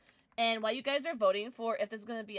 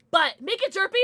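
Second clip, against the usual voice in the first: excited; 9 semitones higher, 11 dB louder, much faster than usual. Real, not acted. Excited request for derpy.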